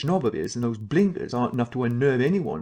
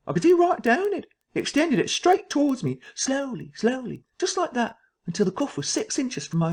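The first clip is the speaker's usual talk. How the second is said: frightened; 12 semitones higher than usual. Acted. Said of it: "I was beginning to feel frightened".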